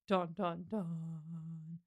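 low, soft "dun, dun, dun"; dramatic sting. Pretend. Host capping the chapter's cliffhanger, mock dramatic sting.

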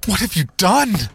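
high, loud accusing cry; shouted. Acted. Narrator shouting at his brothers over breakfast. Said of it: "I shouted".

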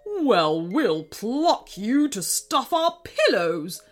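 sneering king. Acted. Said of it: "sneered King Krusty".

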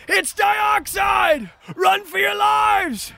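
high, slow terrified shout; panicked scream. Acted. Fleeing townsperson screams a warning, panicked. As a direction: yell to warn the whole town. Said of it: "townspeople screaming".

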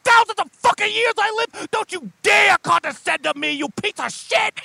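high shouted scorn; angry demon. Acted. Demon boss shouting down a condescending party member. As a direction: bark it down at the punk.

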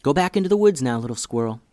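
plain reading voice

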